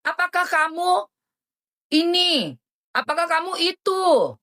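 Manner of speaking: mocking imitation. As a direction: parody the man's loaded question, sing-song. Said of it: "Walaupun di balik kata bertanya".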